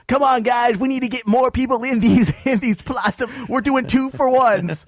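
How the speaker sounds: high, loud pep talk; rally the team like an eager promoter; hammed-up pushy enthusiasm; mock sales pitch. Acted cemetery promoter's sales pitch.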